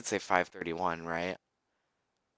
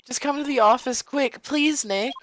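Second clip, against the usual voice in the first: much higher, urgent plea; high pleading girl; 5 dB louder than usual. Acted. Maya begs Nick to hurry to the office, high voice. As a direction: beg him to come in a high girl voice.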